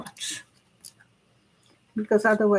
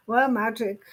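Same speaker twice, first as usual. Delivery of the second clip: ordinary delivery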